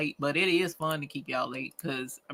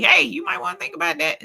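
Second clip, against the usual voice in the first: high, louder friendly heads-up; loving nudge; 13 semitones higher, 5 dB louder than usual. Acted. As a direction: sing out a caring little warning. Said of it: "hug you and love you and say".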